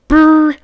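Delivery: loud shivering "brr". Acted. Cold freezer aisle.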